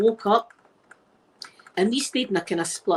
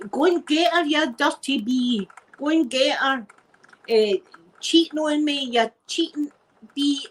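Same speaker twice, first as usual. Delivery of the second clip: retold shouting; 6 semitones higher than usual. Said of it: "over the balcony and shouting".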